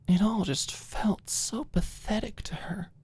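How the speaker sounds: usual speaking voice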